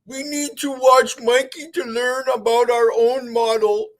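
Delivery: squeaky caricature of a globe believer; mocking globie voice. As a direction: parody the other side as clueless.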